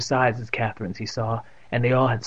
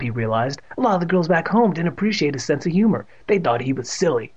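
plain reading voice

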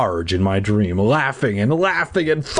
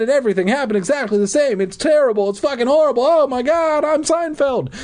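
upset pleading; 9 semitones higher than usual. Acted. Upset boy pleading with parents.

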